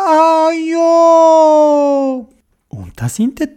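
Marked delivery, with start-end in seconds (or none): 0.1-2.3 underwater gurgling voice. A narrator's reading. Dodo answers underwater.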